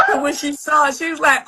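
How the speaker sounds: ordinary delivery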